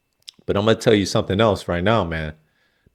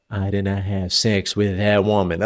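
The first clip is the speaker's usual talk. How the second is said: a lightly voiced Bill Clinton, a bit lower and softer; quick, understated Bill Clinton imitation; Bill Clinton impression; 3 semitones lower, 5 dB quieter than usual. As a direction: attempt a half-hearted Bill Clinton denial. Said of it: "Bill Clinton impersonation".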